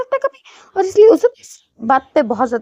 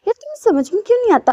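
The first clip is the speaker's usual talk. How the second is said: exasperated; 7 semitones higher, faster than usual. Acted. Pinning him, pleading exasperated.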